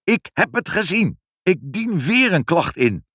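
high-pitched angry yell after a car; indignant shouting. A narrator's reading. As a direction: yell the threat, drawing out the end. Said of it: "hoorden meneer Pinkepank nog schreeuwen".

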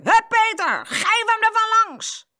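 high, loud, piercing yell; shrill boy shouting from the sidelines; shrill cheer. A narrator's reading. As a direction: egg on the fighter shrilly. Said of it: "hoorde de schelle stem van Frans".

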